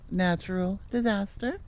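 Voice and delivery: ordinary talk, nothing marked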